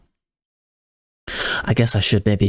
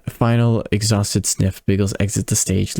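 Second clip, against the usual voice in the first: ordinary delivery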